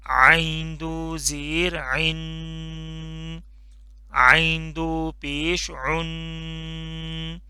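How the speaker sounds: very slow, drawn-out drill for repeating; slow drilled recitation. Shown as a demonstration. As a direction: stretch each syllable for the class to echo. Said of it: "आइन को अच्छे से आपने इसको pronounce करना है". (बहुत धीमे, खींचकर पढ़ाना)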